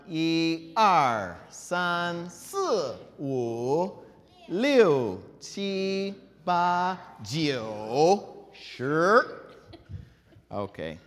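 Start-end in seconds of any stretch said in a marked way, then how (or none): 0.1-9.5 slow, loud counting with every tone exaggerated; counting drill. Shown as a demonstration. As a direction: stretch each number from "一" to "Sure" in its full tone. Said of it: "try it again for fun".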